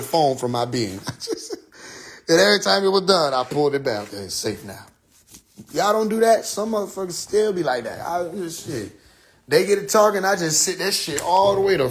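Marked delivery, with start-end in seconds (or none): none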